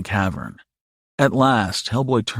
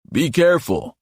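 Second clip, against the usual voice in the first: raised, higher-pitched warning; mild yell, pitch lifted; calling after them; 6 semitones higher than usual. A narrator's reading. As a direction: call a warning after departing children. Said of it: "Hellboy yelled after them".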